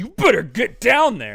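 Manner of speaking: high, loud command; barked order. Retold or imitated. Old uncle shouting orders at his nephew, loud. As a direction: bark it at him. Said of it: "And he's just like".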